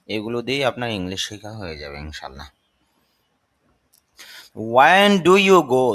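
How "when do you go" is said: slow, loud and very deliberate; teacher reading the English slowly; slow model sentence. Shown as a demonstration. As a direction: drill it word by word for learners. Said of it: "তুমি কখন যাও?".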